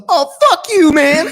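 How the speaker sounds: high, mocking road-rage driver voice; angry driver. Mocking the road-rage driver yelling through the window. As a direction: mimic the raging driver squealing at you. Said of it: "And he goes".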